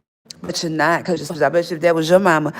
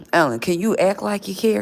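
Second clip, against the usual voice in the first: ordinary delivery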